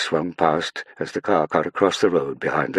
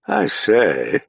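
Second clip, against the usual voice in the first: lightly amused drawl, a touch higher; 6 semitones higher, 5 dB louder than usual. Acted. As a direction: let the Duke drawl it, amused. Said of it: "drawled the Duke".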